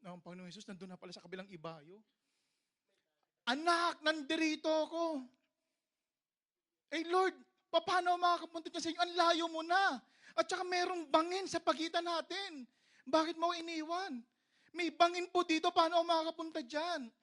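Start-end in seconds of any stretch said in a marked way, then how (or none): 3.5-5.2 high, loud drawn-out call; calling from afar. Acted. Jesus calling from the far side. 6.9-17.1 high, loud protesting shout; calling back helplessly. Acted. Believer shouting back across the ravine.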